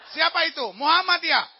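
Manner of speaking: high, loud challenge; acted shouted query; called-out question. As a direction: call back across the border. Enemy calls back across the border.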